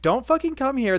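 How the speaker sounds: high, loud mock yell; angry retort. [name] angrily telling off her mother, high mock yell. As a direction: snap it back angrily.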